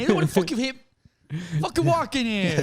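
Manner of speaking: high, loud put-on New Yorker; mock New York yell. Pedestrian reenacts yelling at the taxi, high mock New Yorker. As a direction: rant like a tough guy in the street.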